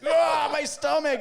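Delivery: high, loud, slow groan of stomach pain; mock agony. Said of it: "I remember being like".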